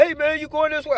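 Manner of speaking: squeaky grateful coworker; coworker's high voice. Retold or imitated.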